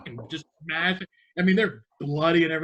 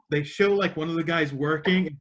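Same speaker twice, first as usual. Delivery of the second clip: ordinary talk, nothing marked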